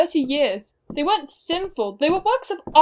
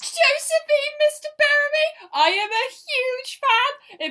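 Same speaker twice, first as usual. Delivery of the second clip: high, slow gushing introduction; breathless fan; 10 semitones higher, slower than usual. Acted. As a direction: gush over your idol. Fan introduces herself to idol.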